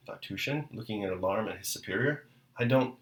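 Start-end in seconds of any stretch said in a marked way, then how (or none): none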